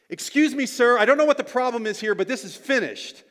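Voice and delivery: loud, high, forceful intervention; confrontational shout. Acted. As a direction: step in and order the man off. Acted confrontation with a harasser.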